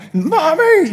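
high, loud imitation of a screaming child; mock scream. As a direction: shriek it like a clinging toddler. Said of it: "you know, screaming".

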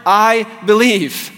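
high, ringing declaration of belief; confident faith. Acted. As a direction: declare it to the Lord with full conviction. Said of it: "when they come to him in faith".

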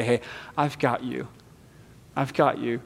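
soft, hushed comfort; tender reassurance. Acted. Jesus softly comforts sinking Peter, hushed and tender. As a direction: quietly hold him up with your voice.

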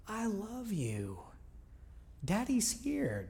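God reassuring a tired father, kind and quiet; kind and gentle. Retold or imitated. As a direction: speak gently like a loving father. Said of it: "It was kind and quiet and gentle".

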